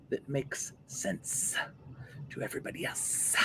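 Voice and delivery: trailing whisper. Real, not acted.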